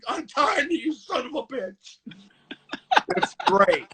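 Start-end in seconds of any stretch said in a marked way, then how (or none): 0.1-2.0 high furious yell fading to a hiss of rage; flipping out. Retold or imitated. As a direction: lose it, then spit the insult. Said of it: "He flips out like".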